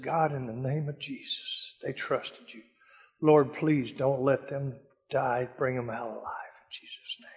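hushed, breathy whisper of a prayer. Retold or imitated. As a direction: plead softly to God, half whispered. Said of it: "I looked at that fire and I said".

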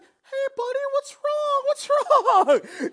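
very high, sing-song coddling voice; mock comforter cooing at someone crying, very high; high-pitched comforting voice. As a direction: coo at them like a hurt child. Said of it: "in a high-pitched voice".

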